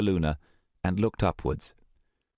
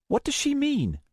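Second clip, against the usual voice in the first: put-on puzzled young woman. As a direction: ask in puzzlement. Luna puzzled.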